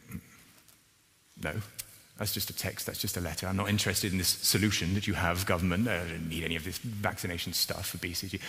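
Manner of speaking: low, soft brush-off; mildly dismissive. Acted. Pastor voicing someone refusing the government's vaccine, mildly dismissive. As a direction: voice a sceptic quietly waving it away.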